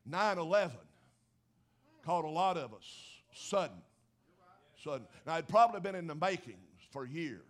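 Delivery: loud, fervent preaching; preacher stirring the congregation with war stories, loud and fervent; preaching at full force. Acted. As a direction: preach it hard with rising passion.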